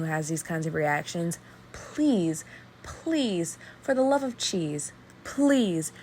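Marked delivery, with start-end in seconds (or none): 1.7-5.9 higher-pitched, drawn-out plea; exasperated, imploring appeal; emphatic pleading. Real, not acted. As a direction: plead with each please set apart. Begging listeners not to minimize.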